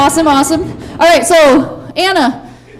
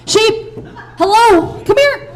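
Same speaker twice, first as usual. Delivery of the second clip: high calling voice; 7 semitones higher, slower than usual. Real, not acted. Calling sheep kids over.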